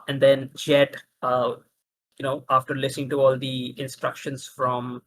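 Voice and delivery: ordinary delivery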